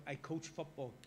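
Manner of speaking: normal talking voice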